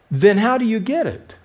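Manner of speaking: put-on a boy truly baffled at the rule; consternation. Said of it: "said in consternation".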